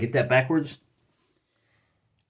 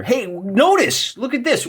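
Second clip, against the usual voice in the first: much higher, slow delighted call to look; eager "look at this" pitched way up; excited; 13 semitones higher, slower than usual. Real, not acted. Host showing viewers the painted table, excited and high.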